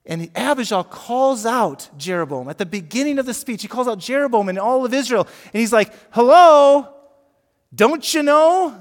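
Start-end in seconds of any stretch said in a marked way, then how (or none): 6.1-8.8 high, loud, drawn-out "don't you know"; loud incredulous call-out. Retold or imitated. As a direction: call him out at the top of your voice. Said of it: "That's how I would say it".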